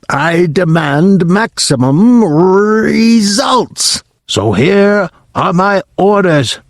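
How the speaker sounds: menacing witch decree; Grand High Witch giving orders. Acted. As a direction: lay down the orders slowly like a tyrant.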